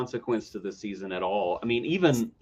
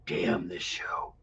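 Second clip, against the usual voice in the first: slow, breathless whisper; whispered curse; 8 semitones lower, 9 dB quieter, much slower than usual. Real, not acted. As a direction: hiss a tired curse.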